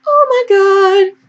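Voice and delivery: high, slow, put-on voice; playful high voice, drawn out; changed voice. Real, not acted. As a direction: say it in a silly high voice, slowly. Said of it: "Turn my voice".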